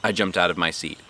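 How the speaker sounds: usual speaking voice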